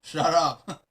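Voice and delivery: normal talking voice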